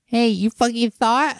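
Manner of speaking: high, slow mock little-girl taunt; imitating a foul-mouthed little girl; little girl yelling. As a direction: squeak it out like a bratty kid. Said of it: "And she's like".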